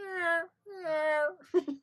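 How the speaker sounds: very high, loud crying meow; imitating the cat. Imitates the cat yowling in the morning, very high and loud. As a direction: wail like a hungry cat. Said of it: "you go".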